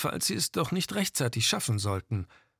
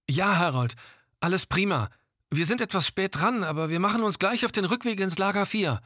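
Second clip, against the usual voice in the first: over walkie talkie; 7 semitones higher than usual. Acted. Gilda answers on the radio.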